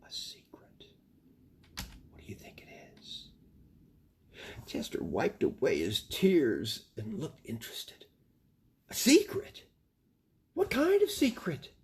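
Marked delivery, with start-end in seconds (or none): 0.1-3.3 whispered aside. Real, not acted. Reader whispers aside to child. 8.9-11.7 put-on much higher, louder eager child voice; young raccoon voice, bright with curiosity; curious little raccoon. Chester asks, interested.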